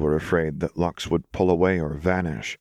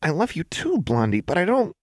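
higher, lightly voiced character reply; confused Jinx; 12 semitones higher than usual. A narrator's reading. Jinx, confused, answering Lux's serious talk, puzzled. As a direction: say it high and puzzled.

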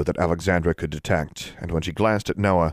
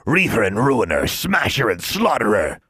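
dwarf voice; 4 semitones higher, 3 dB louder than usual. A narrator's reading. Dwarf lamenting.

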